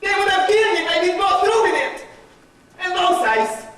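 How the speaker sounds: high, impassioned defence; agitated self-justification. Acted. Judas defends himself to his accusers, agitated and impassioned. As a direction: plead your case with rising agitation, pitched high.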